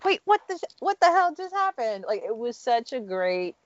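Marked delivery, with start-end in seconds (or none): 0.0-2.0 high, stunned what-the-hell; Will and Jazz stunned by Janet's turn; mock disbelief. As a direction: gasp it out in disbelief. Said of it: "they were just like".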